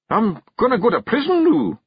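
high, loud Scots question; fearing the law; worried Feegle. Acted. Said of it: "he asked".

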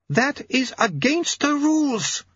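high, slow indignant lady; hissed objection. A narrator's reading. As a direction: protest sharply in a high, drawn-out voice. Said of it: "she hissed".

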